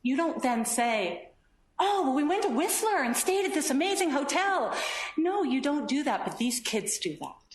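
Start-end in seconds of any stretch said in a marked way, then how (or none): 1.8-4.7 high, excited boast in mimicry; put-on chirpy holiday brag; oblivious gushing. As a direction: gush on as if nothing bad was said. Oblivious brag after partner's bad news.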